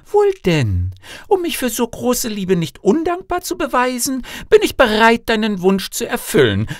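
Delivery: high-pitched, earnest princess voice; princess daughter. Acted. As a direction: agree on one firm condition, pitched high. Daughter sets her condition.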